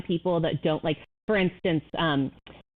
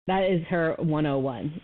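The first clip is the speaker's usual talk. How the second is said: ordinary delivery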